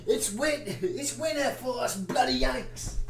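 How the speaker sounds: a bad Aussie impression; mock Australian accent. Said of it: "that was a horrible accent".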